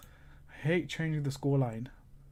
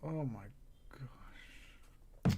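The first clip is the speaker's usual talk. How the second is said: dismayed whisper; 3 semitones lower, 9 dB quieter, much slower than usual. Real, not acted. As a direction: breathe oh my gosh out in a hush. Dismay at what's trending.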